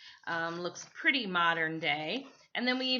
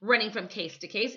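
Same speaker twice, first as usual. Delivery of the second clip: usual speaking voice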